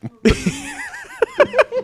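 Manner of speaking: squealing laugh; high laugh. Real, not acted. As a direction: shriek with laughter. Laughing hard.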